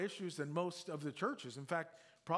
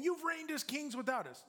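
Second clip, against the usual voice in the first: much higher, mocking echo of the proud; sarcastic; 11 semitones higher than usual. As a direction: repeat it with biting irony. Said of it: "He's, he's saying".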